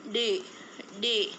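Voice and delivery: डे said twice, retroflex d; sounding out डे. Shown as a demonstration. Teacher drilling डे for learners. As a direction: repeat डे firmly. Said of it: "जिसे हम क्या बोलेंगे".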